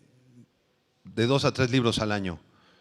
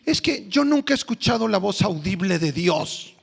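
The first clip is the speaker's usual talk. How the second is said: put-on complaining young man; 6 semitones higher than usual. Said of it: "otro joven vino y me dijo".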